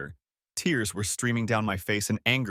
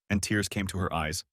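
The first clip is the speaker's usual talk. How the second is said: usual speaking voice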